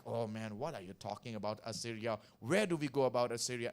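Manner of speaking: mock a doubter; skeptical listener. Preacher imitating a doubting listener, low and soft. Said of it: "You may think like".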